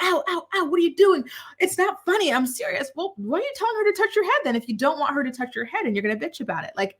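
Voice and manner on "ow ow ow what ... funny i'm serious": high, whining fake pain; mock him whining that it hurts, pitched up; whiny mock complaint. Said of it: "he'd be like".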